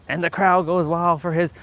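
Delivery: hammed-up sportscaster call; mock commentator. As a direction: sell the crowd going wild.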